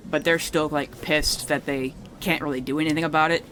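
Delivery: usual speaking voice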